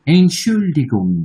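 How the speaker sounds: Entschuldigung stretched out, syllable by syllable; slow German demo. Shown as a demonstration. Teacher repeating the German take for students, slow and careful. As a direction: say Entschuldigung slowly, like a model for learners. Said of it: "German উচ্চারণটা দেখেন কত সুন্দর".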